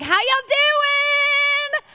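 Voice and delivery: high, slow, drawn-out Southern drawl; Southern accent. Retold or imitated. Mimicking a Southern greeter's accent in a high drawl. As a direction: put on a thick Southern twang. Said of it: "I'm from Queens".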